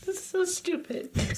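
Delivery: high, helpless giggly voice; struggling not to crack up; real giggles. Real, not acted. Cracking up at own story.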